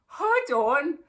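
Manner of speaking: acting out her own disbelief; incredulous surprise. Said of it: "So I'm like".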